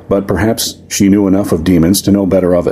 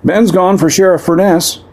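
a lightly voiced young man calling out, pitched up; a young bystander calls out the answer, pitched up; 12 semitones higher than usual. Bystander answers the warlock from the crowd, high calling voice. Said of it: "a young man called".

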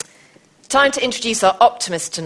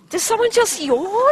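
surprised; 5 dB louder than usual. Real, not acted. Presenter hears audience yawn.

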